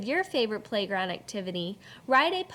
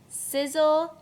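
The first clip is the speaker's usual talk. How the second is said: "Sizzle" louder and higher; sizzle punched; 5 semitones higher, 5 dB louder than usual. Shown as a demonstration. Teacher punches "Sizzle" for the class. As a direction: pop "Sizzle" with energy. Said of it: "poem sound word".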